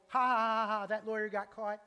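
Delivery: loud scornful cackle at someone's downfall; mocking laugh. A preacher mocking his younger self, loud scornful laugh. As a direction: laugh it up like a smug gossip. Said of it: "I used to be like".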